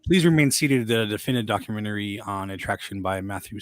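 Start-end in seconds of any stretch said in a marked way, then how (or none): 0.0-0.9 louder announcer ride spiel; theme park ride spiel. Shown as a demonstration. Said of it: "I was gonna do the old s- ride spiel".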